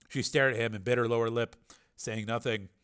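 ordinary delivery, nothing marked

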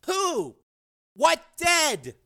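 high, insistent demand; urgent questioning. A narrator's reading. Frank to his raving brother, urgent questioning. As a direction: press for an answer.

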